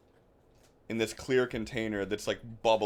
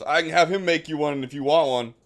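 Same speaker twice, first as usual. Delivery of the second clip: hearty offer; 5 semitones higher, 8 dB louder than usual. Acted. Vardrak offering a drink boisterously.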